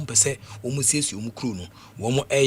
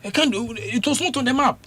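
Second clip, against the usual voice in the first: high incredulous exclaim; can't believe it, pitched up; surprised; 9 semitones higher, 3 dB louder than usual. Real, not acted. Exclaiming in disbelief.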